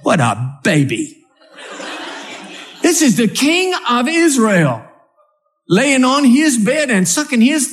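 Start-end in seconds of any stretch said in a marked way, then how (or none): none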